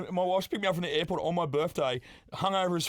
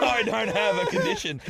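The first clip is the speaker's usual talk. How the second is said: louder, higher acted denial; indignant protest; 5 semitones higher, 5 dB louder than usual. Passenger denying it to the wheelchair attendant, indignant protest. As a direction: push the denial out loudly, offended.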